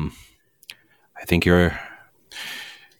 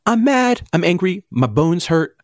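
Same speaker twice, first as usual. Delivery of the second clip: high mock rant; 9 semitones higher than usual. To the hosts, listing his woes, a pitched-up whine. Ranting about his state.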